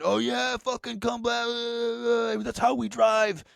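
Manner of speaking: high, strained mimicked yelling; mock screaming. Two gate workers screaming at the driver, high mock yell. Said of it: "they're both fucking screaming".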